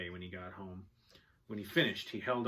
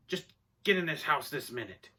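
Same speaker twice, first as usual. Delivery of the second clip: loud, raised-pitch impatient order; angry yell; 6 semitones higher, 6 dB louder than usual. A narrator's reading. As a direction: yell the order, losing your temper. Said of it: "Clifford Snyder yelled".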